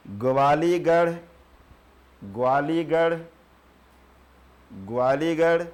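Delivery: slow dictation. Shown as a demonstration. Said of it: "एक लिख दीजिए".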